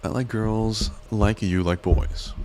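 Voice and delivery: ordinary talk, nothing marked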